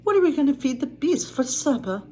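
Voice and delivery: high-pitched, fussing storybook worry; fretting. A narrator's reading. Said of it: "Missus Potts fretted".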